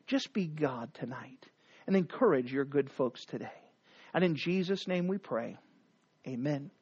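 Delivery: low, soft opening prayer. Real, not acted. Pastor praying softly before the sermon, hushed prayer. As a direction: pray quietly and humbly to God.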